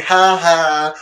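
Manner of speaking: high, teasing ha ha; mock gloating laugh. Prankster teasing a choir friend, high gloating laugh. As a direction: gloat at the victim.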